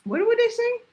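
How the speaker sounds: mock a clueless caller asking; imitating a customer. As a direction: customer calling about blankets, mock high voice. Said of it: "a bitch would call me and be like".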